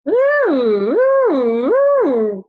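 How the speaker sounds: put-on silly wailing ooh for kids; funny loud ooh. Shown as a demonstration. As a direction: let the voice wail out silly. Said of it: "It's making a funny noise".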